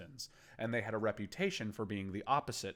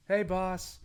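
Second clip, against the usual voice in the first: a bright, higher, louder hello to the boss; guard greeting; 10 semitones higher, 7 dB louder than usual. Acted. Guard greeting the boss at the alley door, brightly. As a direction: call out cheerfully to the boss.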